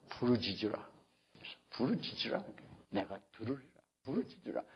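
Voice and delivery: very soft, low, spaced-out call; hushed scripture quote. Acted. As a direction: breathe each phrase quietly, with pauses. (나직이 속삭이듯 띄엄띄엄)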